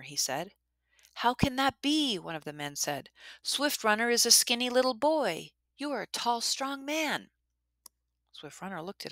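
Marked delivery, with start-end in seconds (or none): 1.1-2.2 amazed man. Acted. Amazed man questions hero. 3.4-7.3 amazed man. Acted. Amazed man questions hero.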